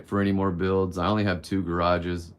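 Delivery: ordinary talk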